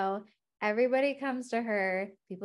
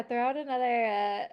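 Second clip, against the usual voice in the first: usual speaking voice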